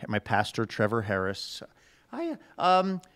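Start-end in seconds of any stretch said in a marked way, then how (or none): 2.1-3.0 a light Irish lilt, pitched up; Irish accent. Retold or imitated. Thanking a listener in the Irish teacher's voice, lightly Irish. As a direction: do the Irish Bible teacher, breezy and friendly. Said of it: "let me try to get there with my Trevor".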